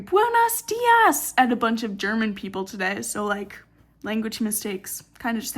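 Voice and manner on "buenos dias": high, loud, drawn-out mock scream; imitated scream. Someone greeting a bunch of German people, imitated scream. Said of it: "I actively heard someone scream".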